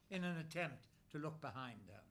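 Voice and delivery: plain reading voice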